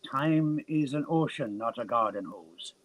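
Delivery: put-on slow, drawn-out Irish accent; botched Irish accent, slow. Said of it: "I was meaning to do a Jamaican accent. I ended up doing Irish".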